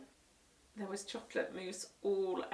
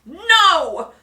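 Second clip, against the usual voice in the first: much louder alarmed no; shouted no; 9 semitones higher, 19 dB louder than usual. Acted. Parents stopping Henry's karate, shouted no. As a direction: stop him now. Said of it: "shouted Mum and Dad".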